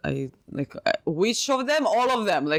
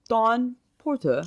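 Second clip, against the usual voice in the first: put-on English accent; 6 semitones higher, slower than usual. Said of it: "working on my English accent".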